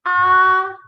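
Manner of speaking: AH held long on a high, level pitch; sustained high level AH; first tone. Shown as a demonstration. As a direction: stretch AH out loud as a flat high line. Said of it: "let's read the tones once more".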